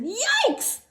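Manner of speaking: high, loud shocked yelp; father yelling in shock at the cart; startled yell. Acted. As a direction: jump back in alarm. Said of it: "Her father turned around and said".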